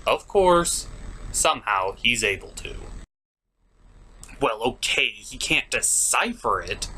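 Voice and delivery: ordinary talk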